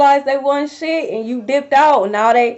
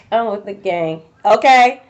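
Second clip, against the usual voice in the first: normal talking voice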